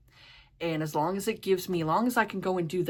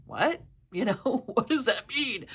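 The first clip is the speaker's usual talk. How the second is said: laughing confusion; 6 semitones higher than usual. Retold or imitated.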